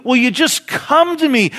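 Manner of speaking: high, loud, impassioned plea; crying plea. Acted. Jesus calling the unsaved to him, crying out. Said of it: "That very cry. He says".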